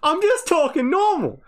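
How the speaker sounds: loud, high-pitched protest; mock exasperation. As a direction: protest at the top of the voice, hammed-up. Exasperated mock defence of own speech.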